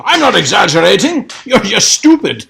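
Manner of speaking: lightly voiced arrogant old professor; haughty old doctor.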